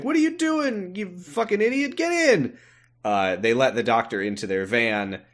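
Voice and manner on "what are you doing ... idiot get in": high-pitched mock yelling; put-on urgent order to a fool; urgent scolding. Masked driver to doctor in the exhaust, urgent scolding.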